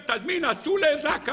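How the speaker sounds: high, urgent retold report; agitated servant. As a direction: keep up the servant's acted alarm. Servant recounting emergency resuscitation.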